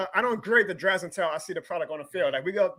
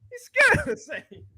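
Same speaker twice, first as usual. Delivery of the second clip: teasing; slower than usual. Pretend. Teasing co-host about fear.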